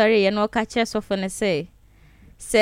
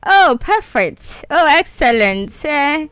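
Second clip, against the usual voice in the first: excited laughing; 5 semitones higher, 4 dB louder than usual. Real, not acted. Excited greeting, laughing with host.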